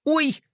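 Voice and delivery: short high-pitched yelp; startled cry. A narrator's reading. Girl falling off a rolling skateboard, yelping. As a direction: cry out in sudden surprise. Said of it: "вскрикнула Глория".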